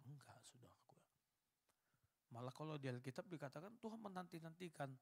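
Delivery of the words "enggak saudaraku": a soft whispered no, gently correcting; sudden whisper. Real, not acted. Preacher whispering to the congregation, gently denying it. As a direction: drop right down to a breathy whisper. Preacher answers his own example.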